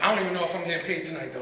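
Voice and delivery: ordinary talk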